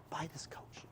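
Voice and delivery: hushed, whispery aside to viewers; whispered plea. Real, not acted. Host urging viewers to buy the coach, whispering. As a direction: drop right down to a whisper.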